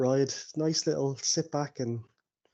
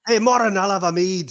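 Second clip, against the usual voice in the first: raised, loud call across a tavern; 5 semitones higher, 7 dB louder than usual. Acted. Dwarf orders a mead from his companion, calling out.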